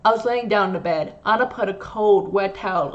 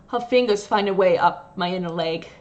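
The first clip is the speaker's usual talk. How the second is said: usual speaking voice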